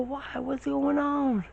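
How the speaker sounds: very high, slow yelled question over wind; high strained shout mid-air; confused shout. Retold or imitated. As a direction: shout out, puzzled.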